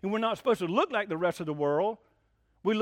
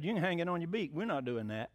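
low, soft quoted refusal; quiet defiance; 4 semitones lower, 8 dB quieter than usual. Retold or imitated. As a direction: drop right down to refuse, unbudging. Acted three men defying the king.

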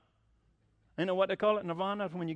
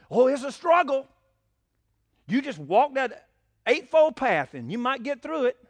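mock Buddhist sage; 5 semitones higher, 5 dB louder than usual. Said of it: "Buddha says what?".

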